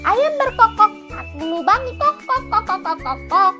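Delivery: high crowing code phrase; sing-out hen signal ending in clucks; clucking chicken. Acted.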